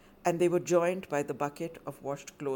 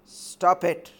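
loud, firm order; commanding; 2 semitones higher, 9 dB louder than usual. Acted. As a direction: stop him with authority. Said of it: "commanded Bablu".

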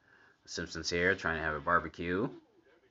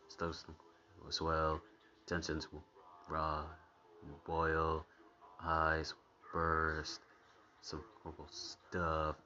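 hushed, halting mock-ominous chant; haunted house voice; 8 dB quieter, slower than usual. Viewer mumbling along with the house's threats, hushed eerie voice. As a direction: intone softly, a phrase at a time. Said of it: "I like how the house is speaking".

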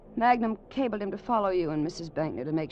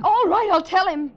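much higher, louder giving in; exasperated outburst; 13 semitones higher, 12 dB louder than usual. Acted. Marion to Rafferty, finally agreeing to tell, exasperated outburst. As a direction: burst out, fed up.

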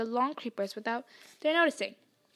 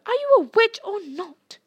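high, loud incredulous shout; outraged yell, pitched high; exasperated bellow; 9 semitones higher, 11 dB louder than usual. A narrator's reading. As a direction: bellow it at a dithering friend. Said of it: "Ron bellowed".

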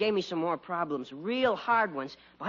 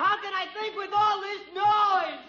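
high-pitched frantic yell; exasperated outburst. Acted. Son driven mad by father's pestering, exasperated yell. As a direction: explode at the noise, voice way up.

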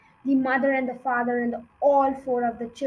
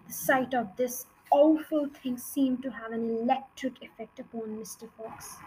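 plain reading voice

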